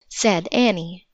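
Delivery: ordinary delivery, nothing marked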